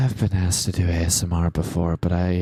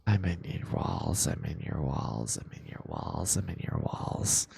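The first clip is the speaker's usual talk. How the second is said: eerie breathy whisper, dropping softer each time; creepy whisper; 2 semitones lower, 6 dB quieter than usual. Pretend. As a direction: whisper it close like a lurker in the walls. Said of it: "whenever I talk like this".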